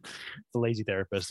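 ordinary delivery

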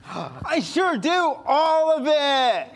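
high, slow, emphatic outburst; gasp, then stretch it out high and eager; eager excitement. Acted. Host tells Toodles they want every tool, excitedly.

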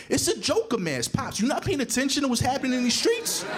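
put-on high-pitched, exasperated child's protest; indignant kid. Boy tells Pops about the streets, high and indignant. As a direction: scold dad like a worked-up little boy.

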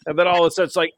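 ordinary talk

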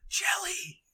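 very high, squeaky repeat in disbelief; hammed-up awestruck hobbit; drunk, amazed Merry. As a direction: squeal it as the punchline. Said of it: "Merry's just like".

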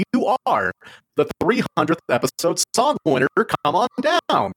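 high, booming announcer; game show host. Pretend.

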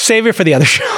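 repeating it while laughing. Real, not acted. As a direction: laugh through the words.

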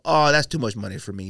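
usual speaking voice

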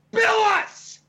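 high-pitched, exasperated retort; acted-out outburst, pitch shooting up; indignant yell. Companion snapping at front desk over payment, indignant. Acted angry reply to billing.

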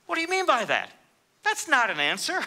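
high-pitched, mock-indignant protest; exasperated retort. Pilate objecting to Yeshua's evasive reply, mock exasperation. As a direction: throw it back as a baffled, put-out Pilate.

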